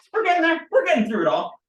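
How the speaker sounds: high, loud progress chant; playful cheer. Real, not acted. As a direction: pipe up with mounting playful excitement.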